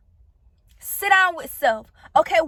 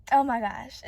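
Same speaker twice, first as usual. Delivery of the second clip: low, soft, really choked up; getting emotional; 5 semitones lower, 6 dB quieter than usual. Real, not acted. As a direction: hold back tears, voice sinking low. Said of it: "'cause I'm getting emotional".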